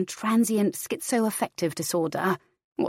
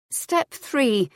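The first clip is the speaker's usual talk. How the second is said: reciting aloud; 7 semitones higher than usual. A narrator's reading. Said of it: "she says aloud".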